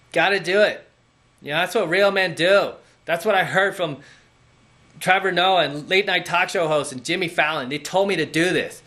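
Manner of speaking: high, whiny mock voice; a sarcastic parody of a pushover man; mocking a brainwashed guy.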